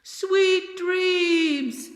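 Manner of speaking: put-on the queen calls up to the girl far above; shouted up. A narrator's reading. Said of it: "shouted the queen".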